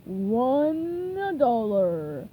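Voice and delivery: very slow, loud, drawn-out offer; sly offer. A narrator's reading. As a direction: dangle it slowly. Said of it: "Plankton offered".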